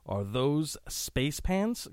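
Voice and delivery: slow read with PANTS lifted into a question; questioning rise. Acted. Host reads out a cheesy pickup line, questioning rise. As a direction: stretch it out and raise PANTS like a question. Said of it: "Question mark".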